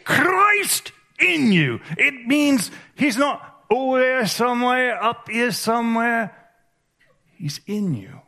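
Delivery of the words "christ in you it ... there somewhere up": Christ in you, very slow, high and ringing in a mock Irish brogue; Irish accent. Shown as a demonstration. Preacher jokingly giving God an Irish accent, slow and high. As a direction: draw out Christ in you in a playful Irish lilt. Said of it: "in an Irish or Scottish accent".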